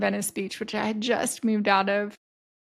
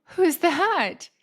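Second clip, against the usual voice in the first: high, sharp, louder "Who's that?"; startled question; 8 semitones higher, 5 dB louder than usual. Retold or imitated. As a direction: blurt it out, suddenly alarmed. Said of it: "And I go".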